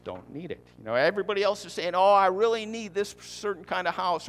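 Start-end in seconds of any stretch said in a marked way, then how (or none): 1.9-4.3 higher-pitched mimicked pleading; mock neediness. Preacher mimicking everybody else's wants, mock needy. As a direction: imitate someone insisting on their wants.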